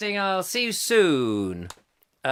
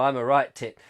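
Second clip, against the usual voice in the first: ordinary delivery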